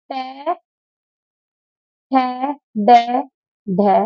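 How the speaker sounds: टे, ठे, डे, ढे said one by one with pauses; sounding out letters. Shown as a demonstration. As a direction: sound out टे ठे डे ढे slowly, one at a time. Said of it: "ये हमारे मूर्धा से बोले जाते हैं".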